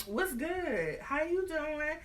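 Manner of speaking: lightly voiced come-on; flirty lady in red. Lady in red greeting Hamilton, mock flirty. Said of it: "She like".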